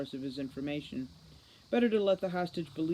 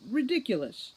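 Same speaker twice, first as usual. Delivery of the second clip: indignant denial; 9 semitones higher, 5 dB louder than usual. Acted. Holly denying, flustered.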